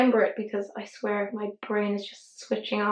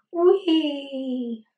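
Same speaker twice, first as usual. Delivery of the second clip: a little higher playful squeal of fun; playful whee; 4 semitones higher than usual. Pretend. Vlogger cheering her brush into the shade, playful whee. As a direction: cheer the brush along like a ride.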